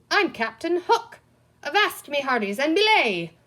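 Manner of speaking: high, loud hammed-up pirate; mock pirate. A narrator's reading. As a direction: ham up Captain Hook. Green man plays pirate.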